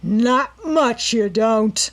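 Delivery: snarled. A narrator's reading. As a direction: grind it out as he pins her. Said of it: "he snarled".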